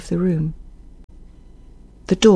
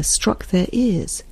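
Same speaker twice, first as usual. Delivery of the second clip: plain reading voice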